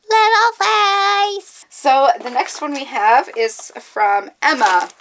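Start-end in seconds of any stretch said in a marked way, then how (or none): 0.1-1.7 high, drawn-out coo over something adorable; cutesy squeal. Real, not acted. Vlogger fawning over a donut charm, cutesy squeal. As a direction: squeal it slowly in a cute high voice.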